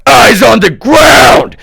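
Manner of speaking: high, loud, slow barked command; angry shout. Retold or imitated. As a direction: yell it like an angry stranger ordering you. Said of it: "literally he's like".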